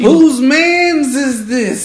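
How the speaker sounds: high, loud and slow, hammed-up indignation; mock outraged boss. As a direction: stretch it out like an offended boss.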